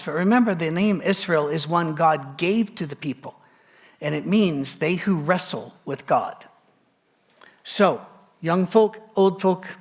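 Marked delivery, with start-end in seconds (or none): none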